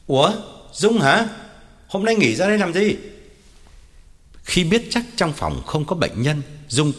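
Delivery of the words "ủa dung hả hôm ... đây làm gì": higher-pitched surprised reply from another room; calling back. Acted. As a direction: answer back through the doorway. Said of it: "đáp vọng ra". (đáp vọng ra, giọng cao)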